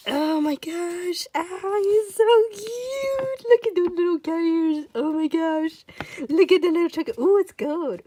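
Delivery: high, loud cooing over something cute; gushing squeal. Real, not acted. Fan cooing over little cat ears, gushing squeal. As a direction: squeal with delight at how cute it is.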